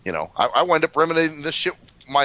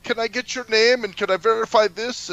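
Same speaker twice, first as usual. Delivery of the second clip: high put-on voice; mock phone agent; 7 semitones higher, 3 dB louder than usual. Mocking the DirecTV agent Dina, high put-on voice. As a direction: parody a polite operator. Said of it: "she's doing all that".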